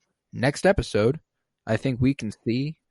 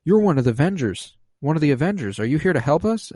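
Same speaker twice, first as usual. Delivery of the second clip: higher, louder startled quote; urgent acted plea; 6 semitones higher, 4 dB louder, faster than usual. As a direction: blurt it out like someone just woken from control. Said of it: "she gasps and looks to Vision and says".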